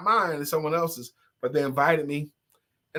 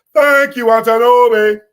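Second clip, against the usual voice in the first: put-on loud, high call in Nigerian accent; hammed-up Nigerian accent shout; 6 semitones higher, 12 dB louder than usual. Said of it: "Put on your Nigerian voice accent".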